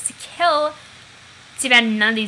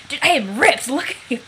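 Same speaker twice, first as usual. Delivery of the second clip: ordinary delivery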